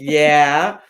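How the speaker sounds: loud, drawn-out yeah with a lift; retold gung-ho yes, belted out; emphatic agreement. Builder recounting her yes to thirty days, emphatic.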